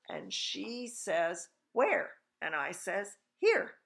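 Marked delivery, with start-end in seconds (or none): 1.7-2.1 high, loud questioning call; baffled mother. A narrator's reading. Mother hunts for her invisible child, baffled call. Mother asks again, louder. 3.4-3.8 very high, loud chirp; piping little boy. A narrator's reading. Invisible boy answers his mother, loud piping call.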